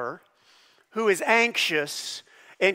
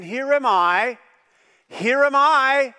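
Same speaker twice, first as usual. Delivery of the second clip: louder, rising call; emphatic calling out; 7 semitones higher, 7 dB louder, slower than usual. Shown as a demonstration. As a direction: call out Here am I like reaching someone. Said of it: "when the Bible repeats things, it is often for emphasis".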